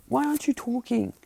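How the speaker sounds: high-pitched mock panic; acted high, anxious outburst; worried demand. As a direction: squeal the question like a worried character. Acted worried character demanding answer.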